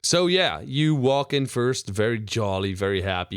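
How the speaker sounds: normal talking voice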